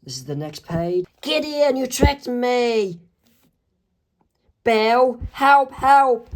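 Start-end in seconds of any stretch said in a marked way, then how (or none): 1.2-3.0 shocked accusation. Acted. Dipper realizes betrayal. 4.6-6.3 high, louder panicked call; cry for help. Acted. Dipper calls to Bill for help while being lifted away, panicked.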